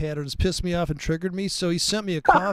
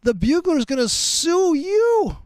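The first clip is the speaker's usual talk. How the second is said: raised, much higher, slowed-down warning to a friend; emphatic warning; 8 semitones higher, 4 dB louder than usual. Retold or imitated. Telling a buddy his trap will get him sued, loud and high.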